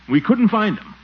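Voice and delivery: ordinary delivery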